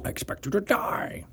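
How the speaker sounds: unvoiced, rasping Connery line; Sean Connery impression. Friend jumping in with Connery's villain line, whispered. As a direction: breathe it out like a mock villain. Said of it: "Just do the Sean Connery".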